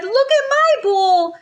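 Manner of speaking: high, quick little bear cry; very high wee voice, fast and upset. A narrator's reading. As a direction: squeal it as a tiny upset bear. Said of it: "cried a little wee bear in his little wee voice".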